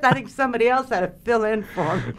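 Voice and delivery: high, loud, amused confession; tickled storytelling with laughter breaking in; laughing. Real, not acted. Laughs at her own missed cue.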